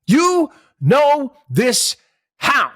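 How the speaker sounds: exaggerated scornful question; drawn-out mock disbelief. Real, not acted. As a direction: drag it out like you can't believe it. Host repeats, drawn out mockingly.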